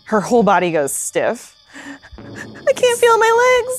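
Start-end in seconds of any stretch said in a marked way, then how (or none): 2.2-3.8 very high, slow panicked cry; panicked squeak. Acted. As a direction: wail it out in panic. Numbed girl panics.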